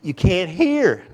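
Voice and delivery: high, loud, squealing panicked babble; frantic Tasmanian devil. Retold or imitated. Preacher mocking people running around in panic, shrill cartoon squeal. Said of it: "the Tasmanian devil".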